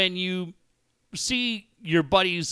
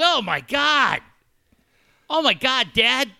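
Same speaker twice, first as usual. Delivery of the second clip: high, loud mock dismay; imitated shocked son; 8 semitones higher, 4 dB louder than usual. Son reacting to dad's mean tweet, mock shocked. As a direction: exclaim like a scandalized kid, pitched up.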